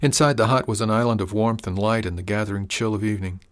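ordinary delivery, nothing marked

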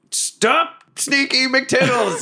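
high, drawn-out mock yell; hammed-up high-pitched cry; acted call to stop. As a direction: call after a runaway crewmate. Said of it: "I'm gonna be like".

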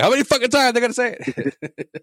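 high, mocking exasperated listener voice; annoyed listener. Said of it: "I bet you the listeners are like".

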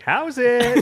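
leering, eager greeting; creepy greeting. Retold or imitated. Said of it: "sounds kind of creepy the way you said it".

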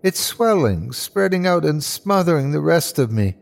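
high-pitched, swelling resentment; mounting bitter anger. Acted. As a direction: let the spite build as it takes you over. Dying man swelling with hate.

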